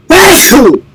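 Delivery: a full, loud sneeze that bursts out; loud real sneeze. Real, not acted. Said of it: "I'm sneezing!".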